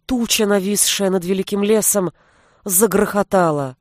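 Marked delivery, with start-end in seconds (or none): none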